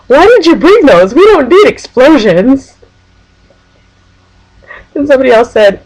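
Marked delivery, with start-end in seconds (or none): 0.1-2.7 high, slow-ending mock protest; imitated complaint. Someone questions what was brought along, mock complaint. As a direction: whine it up high, exaggerated. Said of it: "somebody said".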